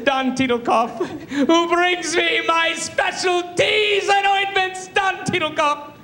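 high, slow, mock-sobbing acceptance speech; James Lipton, weeping speech. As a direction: blubber through the thanks, grandiose to the end. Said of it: "he starts crying at the very end".

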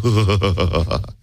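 long shuddering exhale sound; mock shivery breath; imitated shudder. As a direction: imitate a quivering gasp of pleasure. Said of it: "I could make her go".

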